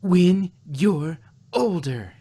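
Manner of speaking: long loud drawn-out yell. A narrator's reading. Twilight shouting back at curious Spike, a slow loud yell. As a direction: yell it over her shoulder, slowly. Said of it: "Twilight yelled".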